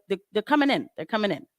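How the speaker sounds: usual speaking voice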